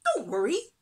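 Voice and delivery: high, loud, swooping lilt; reassuring little fish. A narrator's reading. Little fish comforting friends, high and upbeat. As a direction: cheer them up in a small high voice.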